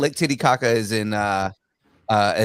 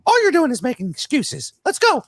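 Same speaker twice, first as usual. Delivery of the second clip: teasing; 11 semitones higher than usual. Real, not acted. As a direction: egg him on with a high teasing voice. Teasing challenge to do voices.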